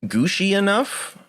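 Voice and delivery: slow, halting client voice climbing high; mock fussy client note; picky client. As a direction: grope slowly for a vague word. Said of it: "I'm coming back and saying".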